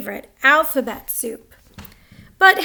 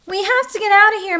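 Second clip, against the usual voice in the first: fast, loud, higher urgent warning; urgent alarm; 7 semitones higher, 5 dB louder, faster than usual. A narrator's reading. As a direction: hurry them out, alarmed. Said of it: "Martha said to Helen and Dad".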